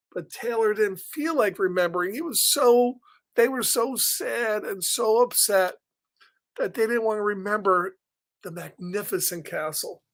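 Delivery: usual speaking voice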